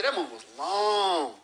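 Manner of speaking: "long" stretched way out; exaggerated complaint, "long" held and punched; emphatic elongation. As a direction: drag out the vowel of "long" to make it feel endless. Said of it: "in how you say it, you're emphasizing".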